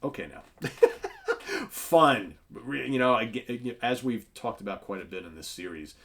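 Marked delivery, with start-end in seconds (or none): 0.5-1.6 high-pitched laugh; amused burst of high laughter. Real, not acted. Host laughs after finishing the story.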